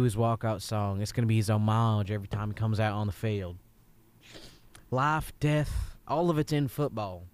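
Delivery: mild Southern coach voice, easygoing; a Southern football coach giving a rousing talk; Southern accent. Acted. Coach telling the kid about walkout songs, in a mild Southern accent. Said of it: "let's just both be Southern".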